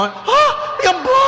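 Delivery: high, loud shocked cry; shocked shout. Acted. Groom seeing the bride unmasked, shouting in shock. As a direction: gasp it out in disbelief.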